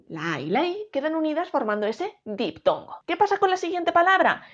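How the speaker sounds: ordinary delivery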